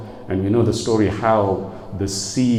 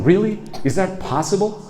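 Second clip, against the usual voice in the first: high-pitched incredulous question; mock astonished asking; imitated disbelief; 9 semitones higher than usual. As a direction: voice the doubters, pitch jumping up. Acted question of doubting people.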